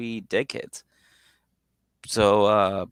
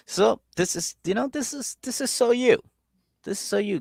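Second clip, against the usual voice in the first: much higher, exasperated mock sister; scolding sister impression; 13 semitones higher than usual. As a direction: scold him like a fed-up big sister. Sister scolding brother, retold.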